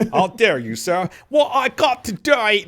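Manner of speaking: a loud, hammed-up rough British accent, rising high; rowdy British accent. Joking about the wrong British accent, a loud hammed-up rough British voice. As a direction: shout it like an indignant British bloke. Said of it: "Not that type of British accent".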